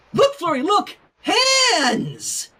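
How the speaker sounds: put-on high excited look-at-this to a toddler; excited distraction. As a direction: hype up the baby to distract her.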